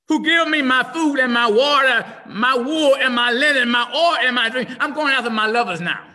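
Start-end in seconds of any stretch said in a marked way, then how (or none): none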